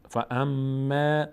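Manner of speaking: فاما with the doubled م hummed long; held nasal meem. Shown as a demonstration. Teacher demonstrating the doubled meem, long nasal hold. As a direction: slowly hold the م of فاما in the nose.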